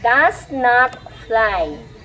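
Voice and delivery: word by word, very slow; slow model reading. Shown as a demonstration. Teacher reading a story to class, slowly word by word. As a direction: stretch each word for learners to follow.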